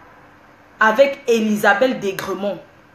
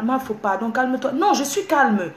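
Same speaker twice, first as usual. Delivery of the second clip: ordinary delivery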